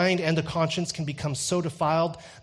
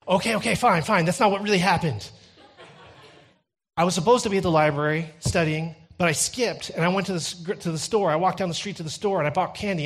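quick, raised-pitch blurted admission; flustered confession; 4 semitones higher, 4 dB louder, faster than usual. Retold or imitated. Caught brother confessing to his sibling to stop the tattling, hurried. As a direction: give in and confess in a rush.

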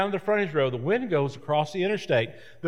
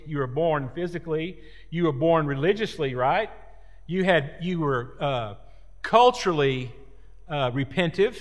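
usual speaking voice

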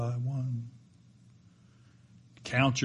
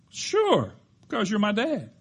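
put-on calm boy; 10 semitones higher, 5 dB louder than usual. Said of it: "responded with remarkable calmness".

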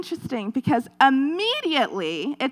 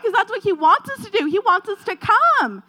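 excited preaching; 8 semitones higher, 5 dB louder than usual. Real, not acted. Preaching God's wish.

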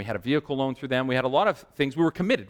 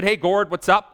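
louder, higher mock-friendly hail; hearty greeting; 7 semitones higher, 6 dB louder than usual. Customer hails the bank manager, hearty greeting. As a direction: call out to him like an old pal.

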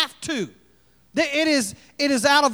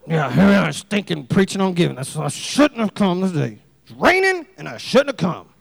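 low, muttered complaint of a reluctant listener; lightly voiced grumpy member; grumbling churchgoer; 6 semitones lower than usual. Grumpy congregant's inner complaint.

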